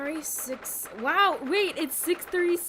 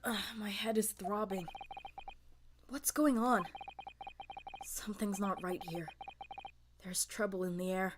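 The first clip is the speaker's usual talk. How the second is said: soft, low, uneasy murmur; quietly acted grogginess, wary; groggy waking sailor; 3 semitones lower, 8 dB quieter than usual.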